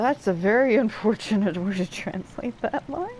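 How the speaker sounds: talking through a real laugh; real amused laughing. Real, not acted. Translator reacting to an unfortunate line, breaking into laughter. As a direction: laugh as the words come out.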